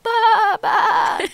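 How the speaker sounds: high, loud imitated bleat; a quick playful goat impression. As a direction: bleat like an excited baby goat. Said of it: "bleating excitedly".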